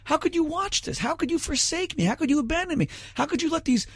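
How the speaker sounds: a crucified man crying out, betrayed; anguished cry to God. Retold or imitated. Debater voicing Jesus on the cross, high anguished plea. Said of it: "Suddenly he says, Oh my God".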